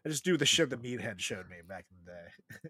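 ordinary talk, nothing marked

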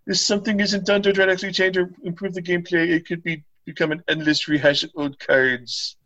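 high-pitched caricature of a complaining Reddit poster. Acted. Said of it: "in the best Reddit voice I can".

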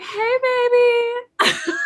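high, drawn-out mock greeting; Colleen. Co-host mimicking the pinned wife, high and drawn out. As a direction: imitate a wife's sweet hello, slow and very high. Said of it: "She's just like".

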